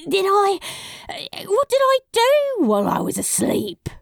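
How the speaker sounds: high-pitched, hurried panicky question; horrified. A narrator's reading. Crowley asking what he did while asleep, horrified. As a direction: stammer the start, then shriek it in alarm. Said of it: "His horror must have shown on his face".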